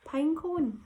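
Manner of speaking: usual speaking voice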